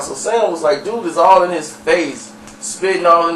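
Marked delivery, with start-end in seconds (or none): none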